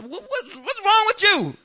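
high, loud mock yell with a laugh. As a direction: blow up at someone, half laughing. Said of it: "if I yell at people".

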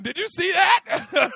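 high, loud and slow, stunned; can't believe what he just saw; drawn-out amazed question; astonished. Retold or imitated. Friend amazed at the miracle.

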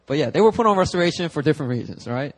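normal talking voice

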